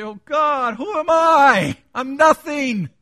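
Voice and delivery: high, shouted, drawn-out self-abasement; impassioned cry to God. Acted. Models a sinner's prayer to God.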